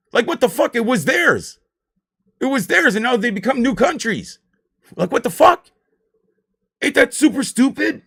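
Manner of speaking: high, loud indignant outburst; outraged disbelief. Real, not acted. Ranting to viewers about history, loud indignant outburst. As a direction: throw up your hands at the absurdity. Outrage over lost Russian lands.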